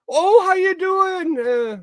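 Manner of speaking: very high, slow greeting with a Minnesotan lilt; hammed-up Minnesotan hello; Minnesotan-sounding imitation. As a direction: mimic a delighted Minnesotan greeting, loud and drawn out. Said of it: "that's a little Minnesotan for what it sounded like".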